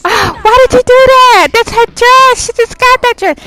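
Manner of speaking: loud, high-pitched cry of outrage; outraged outburst. Acted. Bystander reacts to a drink thrown on a dress, outraged.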